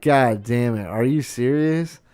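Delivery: ordinary delivery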